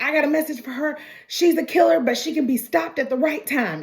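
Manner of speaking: high-pitched mock yelling; imitated frantic yelling, easing toward the end; retold screaming. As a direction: act out a man screaming his warning. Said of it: "he just starts screaming this".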